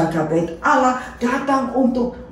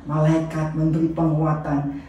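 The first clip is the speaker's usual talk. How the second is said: usual speaking voice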